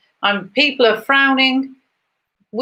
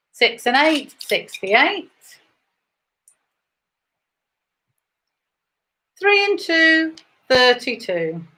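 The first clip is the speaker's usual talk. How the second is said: rising bingo number call; bingo caller; slower than usual. Acted.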